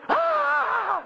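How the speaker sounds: long, very high mock scream; imitated scream. Mother bursting in on the children, imitated scream. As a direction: imitate one sustained high-pitched yell. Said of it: "she kept yelling to them".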